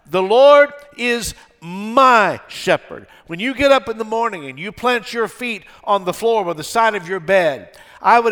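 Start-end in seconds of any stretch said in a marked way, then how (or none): 0.1-3.1 slow, loud, high proclamation; drawn-out, ringing call to God; crying out to God. Acted. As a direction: cry it out, stretching "Lord" and "my". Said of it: "daily crying out to God".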